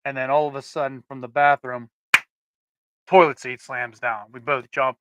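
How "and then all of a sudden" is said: ordinary talk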